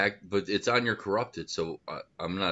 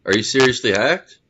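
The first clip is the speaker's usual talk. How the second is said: loud, higher disbelieving question; surprised; 4 semitones higher, 10 dB louder than usual. Real, not acted. Friend hearing the hack story, loud surprised question. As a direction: blurt it out in disbelief.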